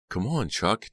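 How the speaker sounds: high, coaxing encouragement; earnest, lilting urging. A narrator's reading. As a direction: coax him along, voice lifted. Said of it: "Dina urged".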